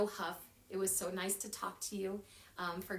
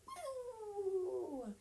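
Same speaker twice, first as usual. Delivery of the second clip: surprised; 9 semitones higher, 6 dB quieter than usual. A narrator's reading. Pepper fleck moment.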